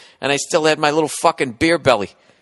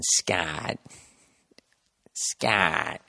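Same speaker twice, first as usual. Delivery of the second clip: Michael Douglas impression; 10 semitones lower, 8 dB quieter than usual. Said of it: "do her Michael Douglas impression".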